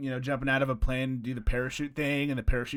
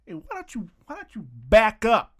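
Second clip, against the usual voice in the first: higher, louder, puffed-up challenge; loud mock threat from a wannabe hard man; mock tough guy threat; 10 semitones higher, 6 dB louder than usual. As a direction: square up like a hammed-up bouncer. Acting out the confrontation.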